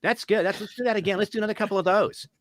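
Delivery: high, excited praise; delighted director. Retold or imitated. Director Sue praises his switched line, high and delighted. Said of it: "And Sue goes".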